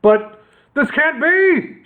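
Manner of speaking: high, slow mock shout. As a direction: wail it in disbelief. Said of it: "shouted Horace".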